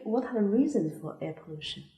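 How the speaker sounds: ordinary delivery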